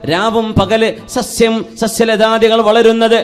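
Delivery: loud, high-pitched sermon delivery; impassioned preaching. Real, not acted. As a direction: preach it out in ringing, sustained phrases. Preaches to congregation.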